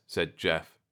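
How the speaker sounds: ordinary delivery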